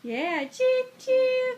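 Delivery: high, slow choo choo; drawn-out train call. Shown as a demonstration. Mother encouraging her toddler with a high, slow choo choo. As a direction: stretch it out like a train whistle. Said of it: "What's the train say?".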